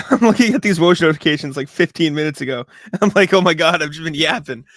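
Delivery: giggling through the words; laughing. Real, not acted. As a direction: laugh it out, amazed at herself. Laughing at herself mid-rant.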